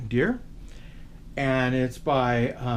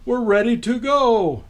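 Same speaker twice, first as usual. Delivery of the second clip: louder and higher, merry Santa; cheerful Santa; 7 semitones higher, 6 dB louder than usual. Acted. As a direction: Santa rallying the team. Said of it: "said Santa".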